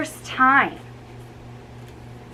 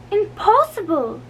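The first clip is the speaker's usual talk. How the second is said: Meg's voice; 10 semitones higher, 3 dB louder than usual. A narrator's reading. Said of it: "said Meg Gurley".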